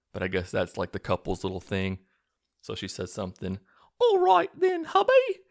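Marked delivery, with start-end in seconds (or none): 4.0-5.4 very high, loud mock British wife; screechy, hammed-up bad British accent; terrible British accent. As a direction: squawk it in an awful British falsetto. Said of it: "the worst British accent that, that I've ever heard".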